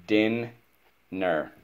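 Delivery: Din and ner said separately with a pause; split into syllables. Shown as a demonstration. Teacher repeats dinner's division for pupil, split into syllables. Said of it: "we divide the syllable".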